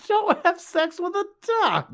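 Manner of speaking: very high, drawn-out mock plea; high-pitched exclamation. Podcaster begging the movie character, high-pitched mock exasperation. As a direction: squeal it slowly, stretching each word.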